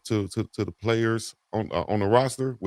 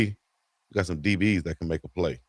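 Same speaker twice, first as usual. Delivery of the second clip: usual speaking voice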